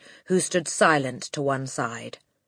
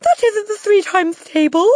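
squeaky, loud protest; Wilfred; 10 dB louder than usual. A narrator's reading. Boy disputing the times table question, high panicked cry. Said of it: "cried Wilfred".

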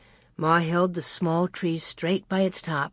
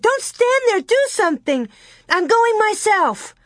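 crying out; 13 semitones higher than usual. A narrator's reading. Carrie urging Laura to search, crying out frantically. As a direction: cry it out frantically, pitch way up. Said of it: "Carrie cried".

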